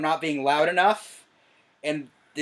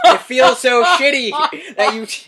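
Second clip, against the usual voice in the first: laughing half-hurt, much louder and higher; 8 semitones higher, 14 dB louder than usual. Real, not acted. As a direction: laugh it out while complaining to dad. Son recalling being yelled at.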